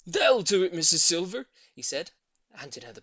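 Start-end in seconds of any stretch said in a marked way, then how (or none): 0.1-1.5 loud, satisfied; confident old man. A narrator's reading. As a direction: hand it over firmly. Voices Mister Hoppy.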